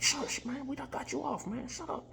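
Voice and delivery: very high squeaky hush-up voice; high squeaky voice hushing the cat; hushing wizard. Retold or imitated. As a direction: shush her in a high squeaky rush.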